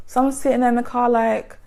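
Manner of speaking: ordinary talk, nothing marked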